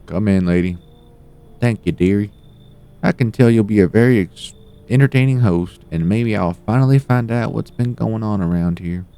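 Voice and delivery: put-on high old lady voice. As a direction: play a sweet meddling granny.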